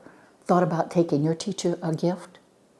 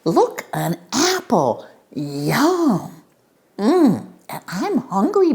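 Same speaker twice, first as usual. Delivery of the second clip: higher, slow greedy bully; 8 semitones higher, 4 dB louder, slower than usual. Acted. Bully voice taking apple.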